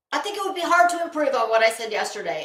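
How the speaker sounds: ordinary talk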